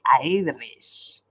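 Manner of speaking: put-on low, drawn-out try at an Irish accent; half-hearted Irish accent; attempted Irish brogue. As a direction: have a bad go at an Irish brogue. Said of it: "I can't do the Irish brogue".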